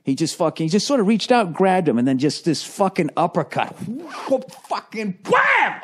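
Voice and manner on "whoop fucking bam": high, drawn-out shouted bam; sudden high whoop into a slow shouted bam; knockout sound effect. Retold or imitated. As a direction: yell the punch landing like a cartoon hit. Acting out the knockout uppercut.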